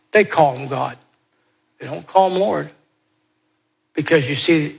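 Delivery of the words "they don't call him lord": ordinary talk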